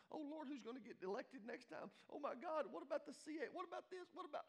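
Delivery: high, rising breathless worry; mock panic. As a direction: spiral into hammed-up panic, ending in gasps. Mocking people who panic over news.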